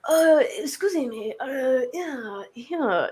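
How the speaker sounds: slow, halting foreigner asking for help; hesitant stranger. Retold or imitated. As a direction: drag out each uh as if reading a translation app. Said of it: "this guy comes over, translating on his phone".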